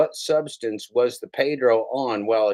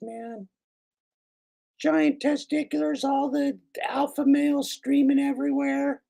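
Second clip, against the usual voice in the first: Pedro fan voice. Acted. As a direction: squeal like a starstruck fan cheering his hero. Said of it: "I like [name]'s voice for the, uh, Pedro fans".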